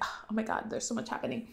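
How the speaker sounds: breathy mock-flustered whisper. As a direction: gasp it out under your breath, overwhelmed. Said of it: "you know, flustered".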